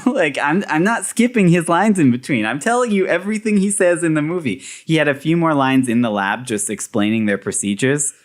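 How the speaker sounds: ordinary talk, nothing marked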